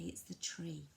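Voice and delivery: put-on soft whisper for a toddler; whispered naming. Shown as a demonstration. Parent softly repeating the word to a toddler, whispered. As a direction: gently whisper the word back. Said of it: "Just repeat it yourself and say".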